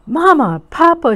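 loud, high call for parents; frightened cry. A narrator's reading. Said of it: "she cried".

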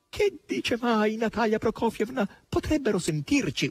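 high, begging wail; imploring high squeal; imploring boar. A narrator's reading. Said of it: "urlò il verro in tono di implorazione".

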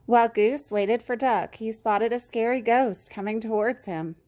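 ordinary delivery, nothing marked

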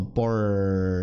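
"Por" with a long English-style R held at the end; held R sound. Shown as a demonstration. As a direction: stop on the R of "Por" and hold it. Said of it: "Esse é o som".

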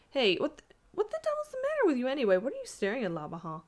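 high, loud, rushed outburst; startled demand. A narrator's reading. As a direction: burst out in startled irritation. Alarmed demand at companions' staring.

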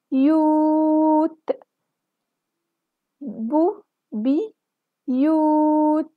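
بيوت said very slowly, split into بي and يوت with يو held long; teacher sounding out بيوت with long waw; stretched long u. Shown as a demonstration. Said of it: "فيها مد بالواو".

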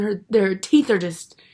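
normal talking voice

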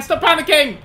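high, loud order to calm down; self-scolding shout. Real, not acted. Player telling himself off after a scare, loud. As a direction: bark at yourself to get a grip.

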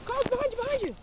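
high urgent calls to a teammate; calling out. Real, not acted. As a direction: warn your teammate from afar. Calling out to Carlos in game.

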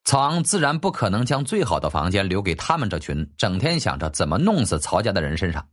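ordinary delivery, nothing marked

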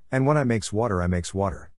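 ordinary delivery, nothing marked

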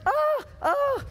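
high squealing delight, oh oh; mock a woman gushing over roses; swooning over a bouquet of roses. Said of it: "She goes".

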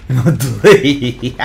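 drawn-out taunt. Pretend. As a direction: stretch each word out to tease. Catchphrase said twice differently. (burla lenta y alargada)

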